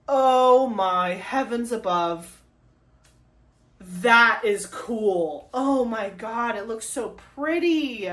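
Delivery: slow, drawn-out marvelling; awed excitement. Real, not acted. Vlogger marvels at her gold card, slowly and emphatically. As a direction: stretch each word out in delighted awe.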